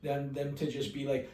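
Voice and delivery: ordinary talk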